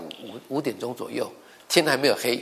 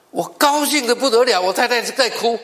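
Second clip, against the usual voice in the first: excited burst; 11 semitones higher, 7 dB louder than usual. Retold or imitated. Husband retells pregnancy news.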